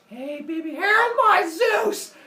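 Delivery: starts high, leaps into a loud drawn-out shriek; hammed-up startled mother; shocked cry. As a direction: build from cooing hello to high scream. Mother finds snakes over baby.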